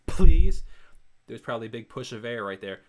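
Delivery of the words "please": PLEASE with a hard, loud burst on the P; popping P. Shown as a demonstration. Singer showing plosives to viewers, popping P on PLEASE. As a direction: blast the P of PLEASE into the mic. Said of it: "like, I'll say".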